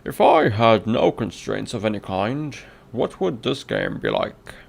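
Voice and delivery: loud, put-on British reading voice; British accent. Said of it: "your British accent".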